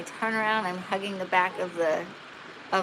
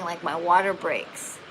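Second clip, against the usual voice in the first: usual speaking voice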